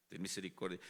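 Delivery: soft, low, calm reading; lectura calmadita. Shown as a demonstration. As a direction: read it meekly, almost under the breath. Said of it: "leer así calmadito". (lectura calmadita, en voz baja)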